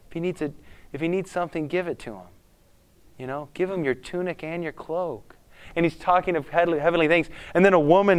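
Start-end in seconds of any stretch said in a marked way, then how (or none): 0.1-5.4 soft, hushed pastor teaching love; quiet, tender sermon voice; gentle preaching pastor. Acted. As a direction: speak softly, like a pastor teaching kindness. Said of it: "the pastor's talking about love".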